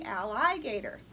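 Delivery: alligator said as al-LIE-gator with "eye" in the middle; al-eye-gator for spelling; spelling pronunciation. Shown as a demonstration. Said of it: "So say it with me".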